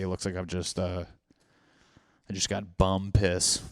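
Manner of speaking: ordinary talk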